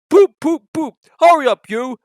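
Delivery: high, very loud engine blast; big engine Gordon. Acted. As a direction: hoot impatiently at the little engine. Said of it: "said Gordon".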